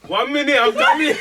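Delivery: high, loud mock shout; calling out. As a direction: call out as if to a crying baby, laughing. Acts out calling to crying baby.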